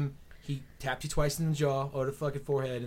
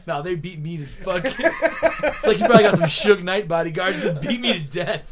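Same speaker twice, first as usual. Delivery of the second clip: laughing; 3 semitones higher, 8 dB louder than usual. Real, not acted. Laughing while joking about bodyguards.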